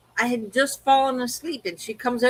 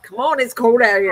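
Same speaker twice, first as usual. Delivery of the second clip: louder, mock-impatient plea; retold cold complaint; 7 dB louder than usual. Owner retelling plea to dog out in cold, loud complaint. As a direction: push it out louder, urging the dog along.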